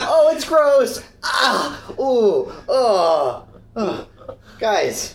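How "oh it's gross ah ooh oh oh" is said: grossed out. Acted. Reacting to pulled thorn.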